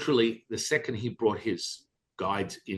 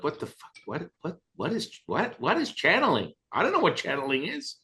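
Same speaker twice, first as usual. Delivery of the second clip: rising, higher confused stammer; baffled; 8 semitones higher than usual. Retold or imitated. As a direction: sputter in confusion. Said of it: "I'm like".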